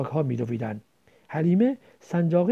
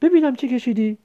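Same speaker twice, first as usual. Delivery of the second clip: curious; 6 semitones higher, 5 dB louder than usual. Acted. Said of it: "کنجکاوانه گفت".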